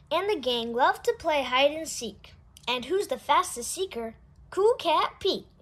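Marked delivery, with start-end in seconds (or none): none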